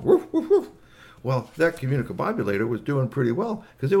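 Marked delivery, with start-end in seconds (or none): 0.0-0.7 high, loud volley of mock barks; dog barking. Maxie talks some more to Igglypop, imitated loud barking. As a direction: bark away like a dog. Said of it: "it started barking along".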